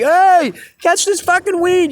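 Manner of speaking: high, loud mock shout to the street; retold call from a window. Friendly resident tossing weed down to the busker, high mock shout. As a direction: call down from upstairs. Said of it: "I've had people throw weed out the window".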